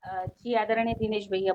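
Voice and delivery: ordinary delivery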